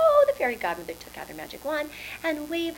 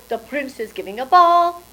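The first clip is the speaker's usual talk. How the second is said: ordinary delivery, nothing marked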